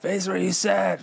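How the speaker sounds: high, mock-yelled coach's bark; imitating coach yelling. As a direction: bark it like the old coach at a timeout. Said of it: "in that same voice".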